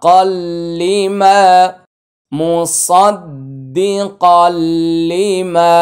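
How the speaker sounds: slow, drawn-out chanted recitation; Quran recitation. Shown as a demonstration. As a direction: recite it with long melodic stretch. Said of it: "recites with melody". (ধীরে সুর করে তিলাওয়াত)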